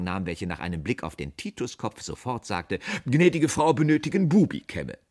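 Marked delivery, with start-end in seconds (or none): 3.0-5.0 high, loud sales announcement; brisk saleswoman. A narrator's reading. Saleswoman sizing up the haircut, high and loud. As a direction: proclaim it brightly to the customer.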